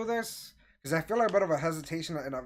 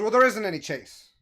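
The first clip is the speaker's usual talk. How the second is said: louder, higher dismissive retort; shutting down; 5 semitones higher, 6 dB louder than usual. Retold or imitated. Manager refusing the customer's request, dismissive. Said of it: "just shutting me down".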